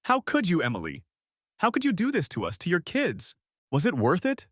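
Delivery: very high, anguished demand; angry sob. A narrator's reading. Wronged wife demanding answers from her friend, anguished. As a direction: break composure, wail the accusation. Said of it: "a sob of anger broke from her".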